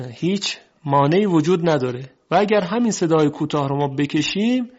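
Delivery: ordinary talk, nothing marked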